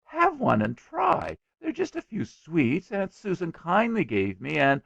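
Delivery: put-on high, insistent boyish pleading; dogged. A narrator's reading. Boy defending his sweets to his father, doggedly. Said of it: "said William doggedly".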